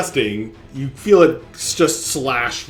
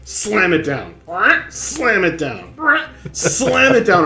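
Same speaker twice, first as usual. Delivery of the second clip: climbing higher with each repeat; building repeated blows; 6 semitones higher than usual. Acted. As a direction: start near normal and drive each repeat higher. Voicing repeated blows of water fist.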